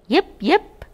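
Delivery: high, sharp imitated puppy yip; a tiny dog yipping from the grass. Narrator voicing a hidden puppy, high yip.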